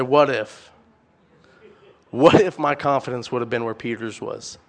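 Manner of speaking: "What if?" dropped low and soft; "What if?" said low, soft and falling, full of regret; regretful what if. Retold or imitated. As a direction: sigh it out with a lifetime of regret. Eleven disciples regretting for life.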